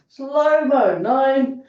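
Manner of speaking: drawn-out slow-motion count. Shown as a demonstration. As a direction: stretch slow-mo out slowly. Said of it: "Two really slow ones".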